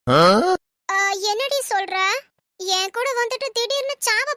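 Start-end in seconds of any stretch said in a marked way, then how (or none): 0.1-1.0 sudden loud low cry of alarm; a startled yelp at the news she'll die; shocked yelp. Acted. Shocked yelp at friend's death talk.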